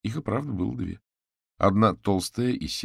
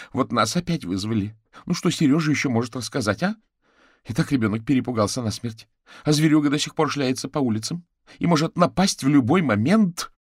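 higher, loud, agitated mother, building; indignant exclaiming; 8 semitones higher, 8 dB louder than usual. A narrator's reading. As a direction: complain heatedly, getting louder and higher. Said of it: "воскликнула женщина". (возмущённо, всё громче и выше)

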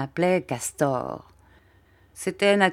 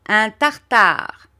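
high, loud, slow barking echo of the master; wooden-legged man's echo; 5 semitones higher, 8 dB louder, much slower than usual. A narrator's reading. The wooden-legged man echoes Creakle in a high, loud, slow bark. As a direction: boom out the word like a lackey parroting his boss. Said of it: "dit l'homme à la jambe de bois".

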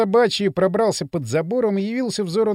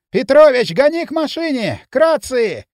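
loud, hoarse, higher yell; hoarse shout; 5 semitones higher, 6 dB louder than usual. A narrator's reading. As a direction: bellow the orders hoarsely. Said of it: "хрипло закричал участковый". (хриплый крик)

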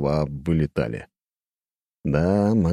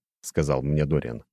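ordinary delivery

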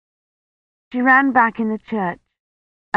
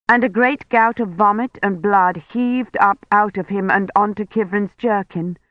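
usual speaking voice

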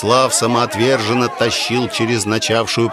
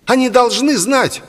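high, overwrought falsetto; insistent boy; 13 semitones higher, 3 dB louder than usual. A narrator's reading. Boy insists before the crowd.

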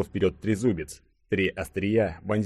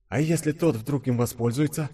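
higher-pitched wondering thought; inner thought; 6 semitones higher than usual. A narrator's reading. Denis worries Caesar might use the device, thinking to himself. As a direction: voice a silent question in the head. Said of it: "подумал Денис".

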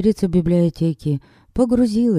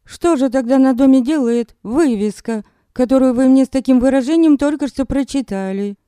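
sarcastic retort; 8 semitones higher than usual. Acted. Heroine retorting to Gilbert.

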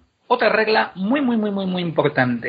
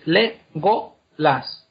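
"le, go, las" as separate syllables; much slower than usual. Shown as a demonstration. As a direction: pause between each of "le, go, las". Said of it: "Si dividimos el nombre en sílabas".

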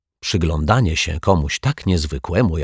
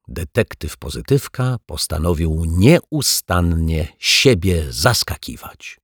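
ordinary delivery, nothing marked

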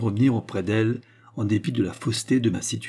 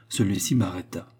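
ordinary delivery

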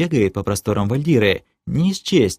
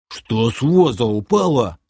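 put-on grumbling sidekick; a touch of hurt grumbling; offended mumble. A narrator's reading. Sidekick told to leave the sword, offended grumble. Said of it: "обиженно пробормотал Ишак".

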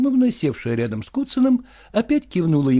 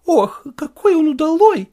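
высокий голос восторженной тётушки; тётка хвалит племянника; голос тётки; 12 semitones higher, 5 dB louder than usual. Acted. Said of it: "вставила своё слово тётка". (тётка вставляет слово и хвалит племянника, голос выше)

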